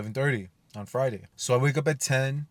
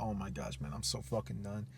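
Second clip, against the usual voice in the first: much softer, sagging and drained; exhausted groan; 2 semitones lower, 12 dB quieter than usual. Retold or imitated. Facing sixty more questions at one a.m., drained and quiet. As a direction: let the words sink into exhaustion. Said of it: "but I was so tired".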